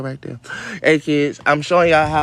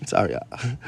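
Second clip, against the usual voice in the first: whispered laugh; 5 semitones lower, 8 dB quieter, slower than usual. Real, not acted. Apologizing through laugh.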